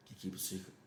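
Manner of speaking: hushed secret whisper. Retold or imitated. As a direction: lean in and whisper it. Sharing a secret with kid.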